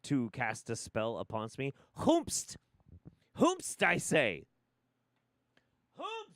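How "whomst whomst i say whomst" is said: high, theatrical cry of outrage; mock-archaic demand. Asking who cast a spell on him, mock-archaic high outcry. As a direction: cry it out like a hammy stage noble.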